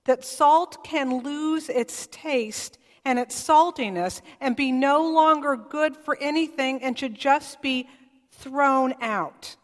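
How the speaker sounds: ordinary talk, nothing marked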